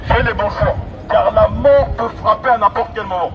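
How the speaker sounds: normal talking voice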